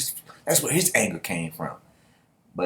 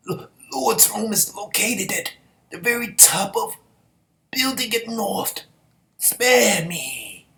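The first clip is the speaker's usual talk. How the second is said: much higher mock pleading; hammed-up whimpering plea, pitched up high; begging victim; 12 semitones higher, slower than usual. Voicing victim giving up info.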